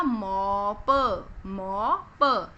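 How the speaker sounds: मॉप in slow pieces, long "mo" then "puh"; sounded out. Shown as a demonstration. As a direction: blend मॉप for children, holding the vowel before प. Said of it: "ये क्या बन गया".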